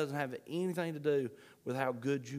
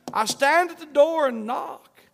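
louder and higher knock line; loud calling voice; 10 semitones higher, 11 dB louder, slower than usual. Acted. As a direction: call it out as the Lord. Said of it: "He says".

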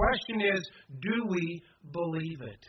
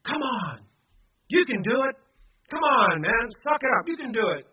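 raised, higher-pitched urging; sideline cheerleading; 6 semitones higher, 5 dB louder than usual. Acted. Preacher mimics what God doesn't say from the sideline, mock urging. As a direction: push them on like an impatient coach. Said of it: "he doesn't stand back and say".

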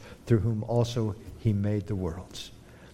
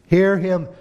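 hear him, higher and louder; louder, rising command; 6 semitones higher, 6 dB louder than usual. Acted. Preacher repeating God's command to the crowd, louder and higher.

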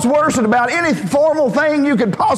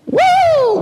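high, loud preacher's whoop; excited whoop; 4 dB louder than usual. Real, not acted. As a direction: let out a joyful whoop. Preacher whoops mid-sermon.

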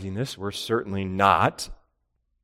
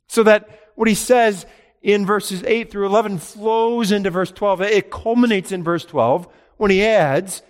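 higher and louder preaching at full force; fervent exhortation; 9 semitones higher, 5 dB louder than usual. Acted. Preacher urging listeners to be saved, higher and louder. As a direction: urge them to look to Jesus.

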